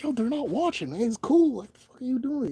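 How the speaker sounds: playful exasperation; exasperated retort. Retold or imitated. Speaker's retort to the HR neighbor.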